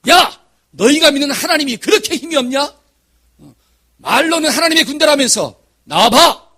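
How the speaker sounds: loud, high-pitched jeering challenge; Goliath's taunt. Retold or imitated. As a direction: shout mockingly across the battle line. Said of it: "뭐라고 이야기하느냐?".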